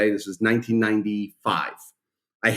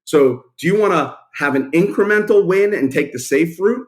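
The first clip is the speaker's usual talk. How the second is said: higher and louder, pushing to close; retold closing push; 5 semitones higher, 5 dB louder than usual. Young salesman closing his pitch to a publisher, loud and pushing. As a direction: push him to take the risk. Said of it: "I gotta go for it".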